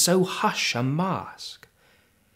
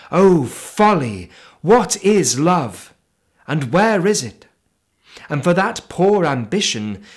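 impassioned outcry; 5 semitones higher, 5 dB louder than usual. Acted. Poet scorning love and ambition, loud impassioned outcry. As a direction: hurl the questions out in passionate scorn.

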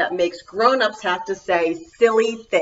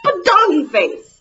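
high and loud; goofy finale; silly; 9 semitones higher, 7 dB louder than usual. Pretend. Last silly line.

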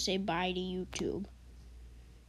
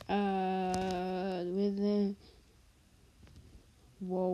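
ordinary talk, nothing marked

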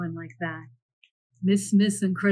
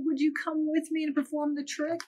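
hopeful Milo; 7 semitones higher, 4 dB quieter than usual. Acted. Milo asks the bear.

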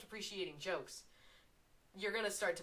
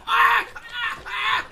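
short, high, loud shrieks; panicked screams; 15 dB louder, much slower than usual. Real, not acted. Gamer who jumped in the fire, panicked high screams. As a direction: scream in bursts as if burned.

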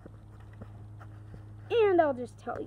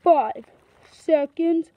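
announcer voice; 8 dB louder, slower than usual. Acted. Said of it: "announces time skip".

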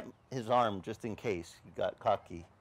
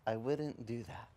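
softer, slow, calm warning; slow quiet voice; 8 dB quieter than usual. Retold or imitated. Preacher voicing the Lord, slow and soft. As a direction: let it land slowly and gently. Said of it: "the Lord goes".

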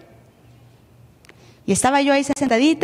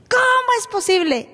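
shocked disbelief; 10 semitones higher than usual. Retold or imitated. Agent shocked at expired papers.

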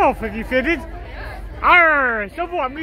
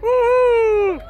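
excited whoop; 7 semitones higher than usual. Real, not acted. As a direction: cheer the crowd on. Cheering at the flash mob.

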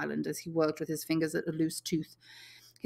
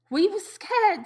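put-on high, eager boy's voice, a little louder; excited; 7 dB louder, slower than usual. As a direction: blurt it out, keyed up. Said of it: "said Piggy excitedly".

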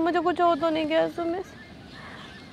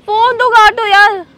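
much louder faked demand; pretend frantic shout; 5 semitones higher, 14 dB louder, slower than usual. Girlfriend yells at boyfriend to cut the call, acted panic. Yelling at him to hang up.

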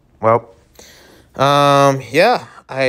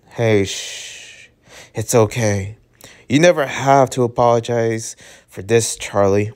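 gentle comfort; 2 semitones lower than usual. Acted. Visitor comforting his crying friend, gentle and hushed.

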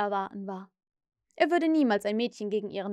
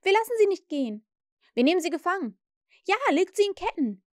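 high, loud and fast threat; boys shouting; 7 semitones higher, 4 dB louder, faster than usual. A narrator's reading. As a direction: yell it at her, no way out. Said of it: "riefen sie".

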